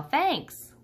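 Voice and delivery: high, bright call after someone leaving; yell. A narrator's reading. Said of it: "before George can yell".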